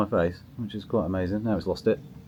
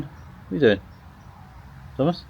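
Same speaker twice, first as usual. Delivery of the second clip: talking to the cat; 7 semitones higher than usual. Real, not acted. Speaking to the cat.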